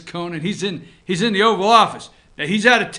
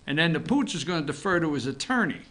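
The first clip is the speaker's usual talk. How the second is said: usual speaking voice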